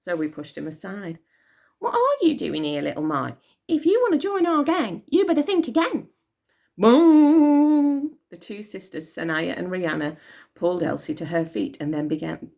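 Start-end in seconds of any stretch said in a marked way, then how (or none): 1.8-6.1 put-on high, loud sassy gang girl; tough girl. A narrator's reading. Zoe warning Elsie off, high sassy voice. 6.8-8.2 voiced bong like a big clock bell. A narrator's reading. Narrator voicing Big Ben striking, bell bong.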